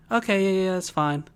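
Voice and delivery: normal talking voice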